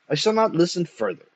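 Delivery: put-on an old man's indignant declaration; haughty refusal. A narrator's reading. Old poet refusing his family.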